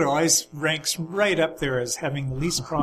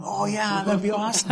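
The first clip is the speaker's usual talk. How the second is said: higher-pitched, mildly eager imitation of the boys; retold teenage eagerness; 6 semitones higher than usual. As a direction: lightly act out eager teenagers saying yes. Retold teenagers eagerly accepting a drive.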